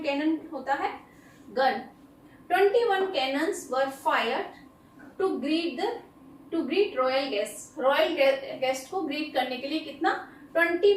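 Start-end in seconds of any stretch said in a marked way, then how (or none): 2.5-7.8 very slow, every word spaced out; slow dictation. Shown as a demonstration. Teacher reading an example sentence very slowly to students. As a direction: read it out slowly so students can write it down.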